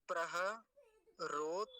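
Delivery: "प्रहरोत्तरार" sounded out slowly, syllable by syllable; slow pronunciation demo. Shown as a demonstration. Teacher showing students the word again, slowly sounded out. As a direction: break "प्रहरोत्तरार" into slow, separate pieces. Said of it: "अब यहाँ फिर से देखिए".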